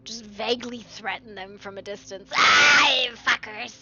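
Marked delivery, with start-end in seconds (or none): 2.4-3.7 loud exasperated cry; frustrated outburst. Real, not acted. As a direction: curse them out loud.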